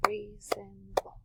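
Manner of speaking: very slow, quiet "Reasonable." in beats; slow soft syllables. Shown as a demonstration. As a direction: stretch "Reasonable." softly to count. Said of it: "how many do you guys hear".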